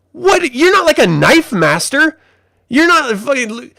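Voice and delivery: high-pitched mock disbelief; incredulous scolding. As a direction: scold the character in exasperated disbelief. Mock scolding of character's knife stunt.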